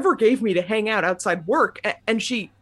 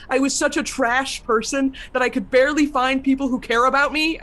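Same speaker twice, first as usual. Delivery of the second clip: anguished; 3 semitones higher than usual. Acted. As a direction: cry out in anguish. Anguished outburst of despair.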